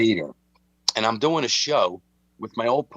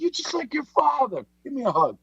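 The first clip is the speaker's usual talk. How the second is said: much higher, put-on voice of an indignant old gangster; old Pete's voice. Telling Mike about his father, indignant high old-man voice. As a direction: play Pete, outraged he laughs. Voicing old Pete, retold.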